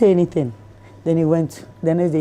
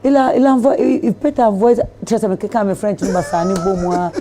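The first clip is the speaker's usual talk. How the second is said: higher, louder French on the phone; translating in French; 5 semitones higher, 3 dB louder than usual. Retold or imitated. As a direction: speak French politely as a phone interpreter. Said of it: "so I was like".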